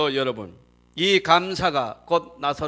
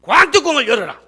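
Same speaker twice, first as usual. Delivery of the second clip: 관뚜껑을 열어라 called out high and loud; preacher's own bold rewording of the command, loud and high; loud commanding version; 7 semitones higher, 10 dB louder than usual. Shown as a demonstration. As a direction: thunder the order 관뚜껑을 열어라. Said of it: "나는 고칩니다".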